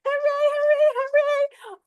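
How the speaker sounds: very high, loud cheering; joyful cheer. Real, not acted. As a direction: cheer for the pregnant mom. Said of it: "I'm trying to contain myself".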